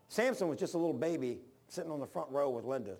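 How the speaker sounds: ordinary talk, nothing marked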